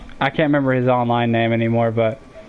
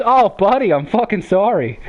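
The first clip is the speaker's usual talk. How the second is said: high, emphatic Jersey commiseration; mock-pitying Jersey buddy; imitated Jersey accent; 8 semitones higher than usual. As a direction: lay on the Jersey sympathy, pitched up. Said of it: "The hardcore Jersey accent".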